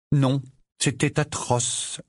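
put-on slow, young boy's glum reply; Harry's voice. Harry telling Sirius the holiday was awful, glum. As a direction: glum teenager.

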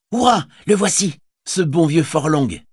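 put-on a boy cheering the lord's arrival; excited cheer.

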